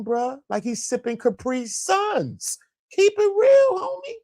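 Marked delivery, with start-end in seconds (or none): 2.9-4.1 much higher pitched, loud mock plea; squeaky keep it real. Real, not acted. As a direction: squeal it in a high comic voice.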